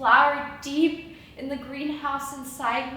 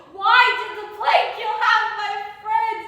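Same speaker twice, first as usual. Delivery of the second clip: desperate cry; 7 semitones higher, 5 dB louder than usual. Acted. Mounting desperate questions.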